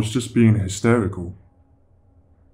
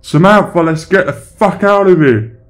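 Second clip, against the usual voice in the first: desperate high yell at full force; panicked shouting; 10 semitones higher, 12 dB louder than usual. Acted. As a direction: scream for your dog to get away with you. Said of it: "I was paranoid and started shouting".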